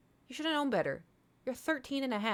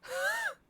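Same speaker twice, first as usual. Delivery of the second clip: sharp gasp. Real, not acted. Hears cat choking.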